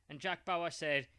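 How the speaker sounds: usual speaking voice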